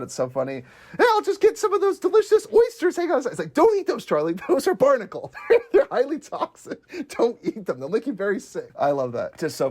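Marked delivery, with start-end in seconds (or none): 1.0-3.3 higher, put-on voice for a goofy character; acted character quote. Acting out Charlie scene. 3.5-8.7 higher voice, laughing through the warning; laughing warning quote. Retold or imitated. Acting out warning to Charlie.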